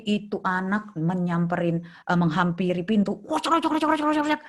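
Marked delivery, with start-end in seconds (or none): 3.2-4.4 higher mock rattle of a door handle; imitated door rattling. Therapist imitating the child yanking the door handle, higher rattle sound. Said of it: "Gagang pintu itu seperti mau copot".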